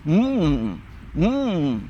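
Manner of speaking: straining closed-mouth hums; lightly mocking mute hum; mute man's humming. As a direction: mimic a mute trying to talk. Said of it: "Não conseguia falar o cara".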